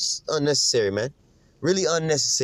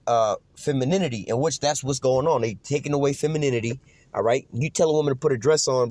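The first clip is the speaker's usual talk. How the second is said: usual speaking voice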